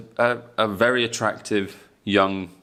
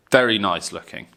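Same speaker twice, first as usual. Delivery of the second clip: usual speaking voice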